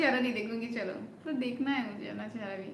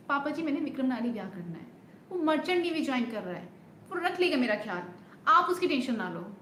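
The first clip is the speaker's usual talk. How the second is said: rushed film dialogue; faster than usual. Acted. Streamer auditioning the film scene, fast and pleading. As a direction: rush the heroine's plea. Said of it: "audition नहीं दे सकती".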